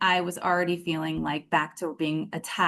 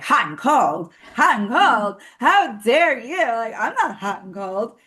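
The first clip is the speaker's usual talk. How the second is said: higher, hammed-up outrage with swooping emphasis; mock indignation; 4 semitones higher than usual. Retelling her inner reply to his accusation, mock indignation. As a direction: act out being scandalized by the accusation. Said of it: "I didn't say it like that".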